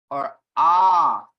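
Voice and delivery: ah drawn long and loud; ah held long, called out; dragged-out ah. Shown as a demonstration. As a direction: drag out the ah sound. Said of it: "Ah is kinda dragged out".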